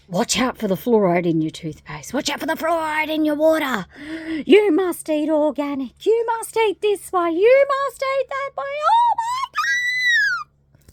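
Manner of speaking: hammed-up escalating alarm ending in a long squeal; mock alarmist. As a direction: panicky warnings climbing to a high, loud shriek. Mimics fearmongers, building to a scream.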